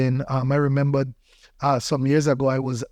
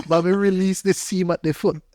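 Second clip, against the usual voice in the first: ordinary talk, nothing marked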